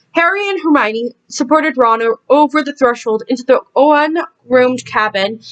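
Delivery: plain reading voice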